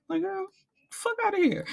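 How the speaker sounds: squeaky high exasperated dismissal; outraged disbelief. Real, not acted. Speaker's own outraged aside.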